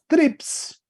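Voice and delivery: trips said high with a sharp stress; accent on iota. Shown as a demonstration. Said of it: "tiene un acento agudo ahí en la iota". (trips, con acento agudo, alto)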